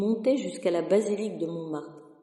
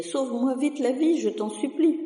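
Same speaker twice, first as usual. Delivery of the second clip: high, louder, begging out of breath; breathless pleading; 6 semitones higher, 3 dB louder than usual. Acted. Sacristan begs the carpenter to save him, breathless. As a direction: plead for rescue, still panting. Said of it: "cria-t-il tout essoufflé".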